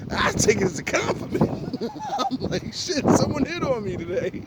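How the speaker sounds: high and breathless with laughter; laughing. Real, not acted. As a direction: squeal it through giggles. Laughing at being hit on.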